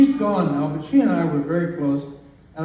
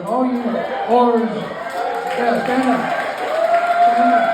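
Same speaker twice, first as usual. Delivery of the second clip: raised voice over the crowd; rally the whole family to stand; higher, projected call to the room; 6 semitones higher, 4 dB louder, slower than usual. Real, not acted. Calls all the children to stand.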